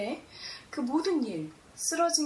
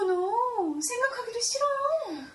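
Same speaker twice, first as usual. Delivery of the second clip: mock horror; 9 semitones higher, slower than usual. Recoiling from a bad memory.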